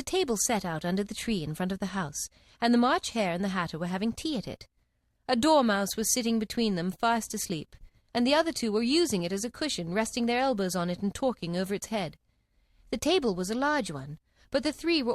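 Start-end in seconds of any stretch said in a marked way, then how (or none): none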